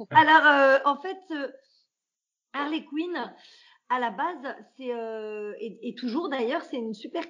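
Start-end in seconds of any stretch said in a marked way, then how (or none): none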